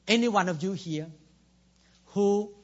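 usual speaking voice

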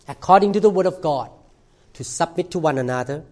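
usual speaking voice

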